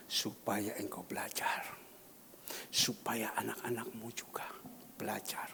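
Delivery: soft, low whisper; whispered reply. Acted. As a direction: whisper God's answer gently. Preacher voices God's answer. (bisikan pelan)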